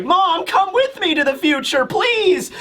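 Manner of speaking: put-on high-pitched whiny child voice; mock pleading. Retainer mimicking a boy begging his mother, mock whiny plea. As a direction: whine and beg, rising on please.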